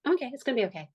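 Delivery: quick high-pitched mock reassurance; retold self-reassurance. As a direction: pitch it up, imitating your own stock reply. Retold upbeat self-reassurance to friends.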